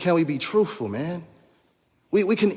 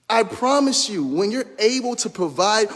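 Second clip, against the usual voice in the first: ordinary delivery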